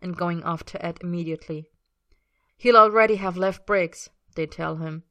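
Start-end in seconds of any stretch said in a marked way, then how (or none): 2.6-4.1 stern. A narrator's reading. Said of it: "stern speeches".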